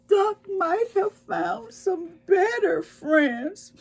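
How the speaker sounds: high, slow and teary; a lonely cat fearing the worst; sad little voice. A narrator's reading. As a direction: let it wobble like a small sad cat. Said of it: "whispered in a sad little voice".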